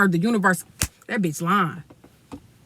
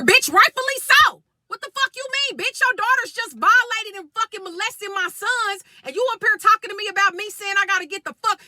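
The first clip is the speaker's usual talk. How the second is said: much higher pitched furious outburst; furious rant; 10 semitones higher than usual. Real, not acted. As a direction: let the fury burst out, voice up high. Furious at the daughter's mother.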